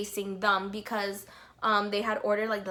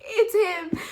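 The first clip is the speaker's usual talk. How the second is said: much higher, louder, laughing; giddy laughing squeal; 8 semitones higher, 7 dB louder than usual. Retold or imitated. Fan sees idol grab phone, high laughing squeal. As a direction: squeal it in disbelief.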